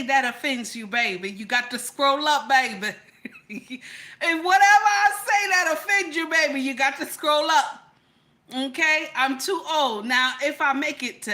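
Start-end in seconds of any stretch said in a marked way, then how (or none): 3.5-4.2 quiet, quick chuckle; brief soft laugh; short laugh. Real, not acted. Laughs between the two takes. 4.2-7.8 higher, louder insistence; loud, high-pitched telling-off; emphatic warning. Real, not acted. Emphatic repeat of warning to viewers.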